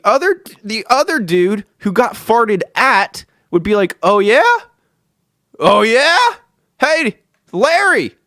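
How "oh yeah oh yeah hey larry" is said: higher, louder taunting oh yeah; mock challenge. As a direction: rise up teasing, ready to fire back. Other dude retaliating.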